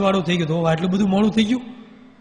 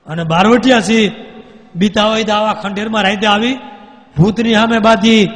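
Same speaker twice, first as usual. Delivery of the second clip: a ghost's booming challenge, much louder; loud boast; 4 semitones higher, 8 dB louder than usual. Acted. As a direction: boom it out proudly at the outlaws.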